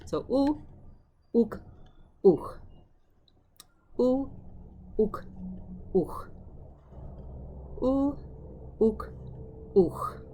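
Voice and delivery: ou high and open, ook clipped after it; contrasting forms drilled. Shown as a demonstration. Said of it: "you need to make it ook".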